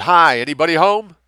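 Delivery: loud, high yell into the house. Acted. Lessing yelling before kicking the door, loud and high. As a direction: belt it toward the door. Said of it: "Lessing yelled".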